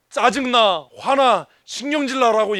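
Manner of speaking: loud, fed-up 짜증나 and 화나; a grumbler venting, hammed up for the congregation; mock irritation. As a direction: blurt it out like an annoyed complainer. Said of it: "얘기할 때".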